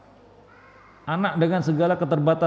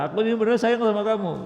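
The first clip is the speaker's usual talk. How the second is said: quick, higher mock claim of love; angry person's excuse; 7 semitones higher, faster than usual. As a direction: rattle it off, higher and fast, like a hollow excuse. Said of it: "lalu dia berkata". (pura-pura sayang, cepat dan tinggi)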